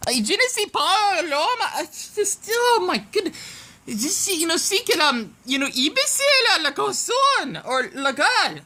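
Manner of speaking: high, hammy French accent imitation; mock French accent. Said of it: "even French speakers could talk much more clear than this".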